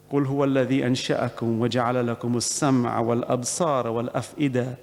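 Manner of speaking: fast chanted Quran recitation; Quranic recitation. Real, not acted. Preacher quoting Allah to his congregation, fast chanted recitation. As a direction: chant the verse quickly, in one sweep.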